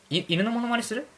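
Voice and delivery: normal talking voice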